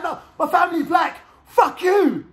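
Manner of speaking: very high, shouted protest; acted angry outburst. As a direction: re-enact screaming back at the judge. Outburst at the judge in court.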